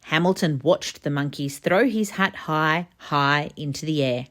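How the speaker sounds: ordinary delivery, nothing marked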